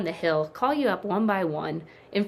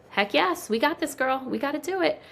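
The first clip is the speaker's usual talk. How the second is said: high-pitched retold cheerful yes; rally her with an upbeat, raised voice; eager agreement; 9 semitones higher than usual. Retold eager yes to friend's request.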